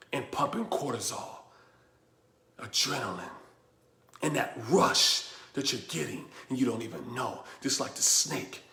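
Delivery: low, soft and grave; hushed warning. Real, not acted. Speaker warning listeners they are like the snake, hushed. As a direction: bring it right down, quietly intense.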